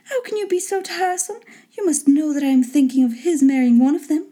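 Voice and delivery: high-pitched chiding lady; put-on high, exasperated wife voice. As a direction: pitch it up and scold the husband lightly. Wife chides husband.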